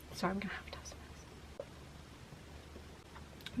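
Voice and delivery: quick whispered aside. Real, not acted. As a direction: drop to a whisper. Aside before sipping hot drink.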